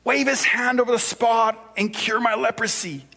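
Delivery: high, whiny complaining Naaman. Retold or imitated. Preacher reading Naaman's complaint to the congregation, whiny. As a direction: whine it like a spoiled big shot. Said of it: "I have to use a whiny voice".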